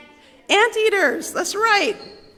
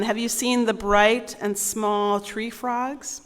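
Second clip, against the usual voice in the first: ordinary delivery, nothing marked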